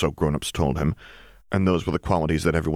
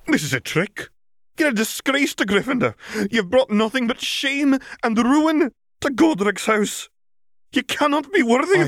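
put-on high, louder outraged tirade in an old woman's voice; furious shout; 3 dB louder than usual. A narrator's reading. McGonagall denouncing Riddle to the great hall, furious high shout. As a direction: denounce him from the high table. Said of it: "McGonagall shouted".

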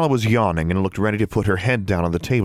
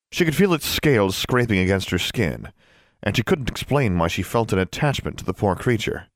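ordinary delivery, nothing marked

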